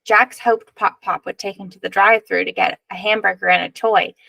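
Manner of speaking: usual speaking voice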